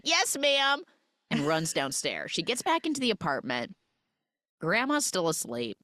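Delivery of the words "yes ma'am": high, quick-to-obey mock kid; imitated obedient girl. Girl obeying the old woman, high mock obedience. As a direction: answer up high like a told-off child. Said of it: "Bee immediately is like".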